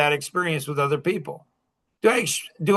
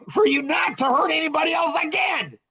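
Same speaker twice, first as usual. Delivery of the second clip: much higher, forceful; emphatic shout; 10 semitones higher than usual. Real, not acted. Coach insisting the man must stop hurting others, shouting. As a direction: drive the point home hard.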